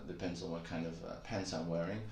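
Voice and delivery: ordinary talk, nothing marked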